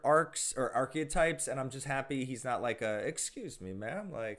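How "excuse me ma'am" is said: lightly voiced posh preppy boy, softer; a hint of super posh politeness, kept quiet; Carlton. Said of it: "Oh, I get super posh".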